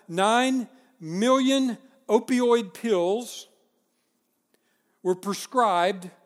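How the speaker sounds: slow, weighty emphasis on "nine million opioid pills"; stressed slowly. Real, not acted. Preacher stressing shocking number, slow emphasis. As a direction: let each word land heavily. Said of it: "just think about that".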